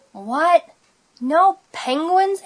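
high, drawn-out disbelieving question; dismayed question. A narrator's reading. Splat reading the closed-pool sign, dismayed question. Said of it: "asked Splat".

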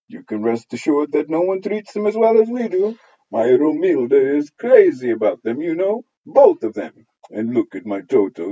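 high, loud, fawning matron's voice; gushing neighbour. Acted. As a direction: coo over the children like a busybody neighbour.

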